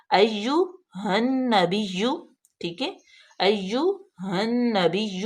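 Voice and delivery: "ایہا النبی" very slow, doubled نون pressed; drawn-out "ایہا النبی"; careful recitation. Shown as a demonstration. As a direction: model "ایہا النبی" slowly. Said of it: "ہا کو ہم direct نون میں ملا دیں گے".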